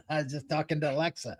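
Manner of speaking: quick, soft remark after a laugh; quick and quiet. Real, not acted. Host tells caller about Alexa, quick and quiet. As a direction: toss it off softly and fast. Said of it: "You've been what?".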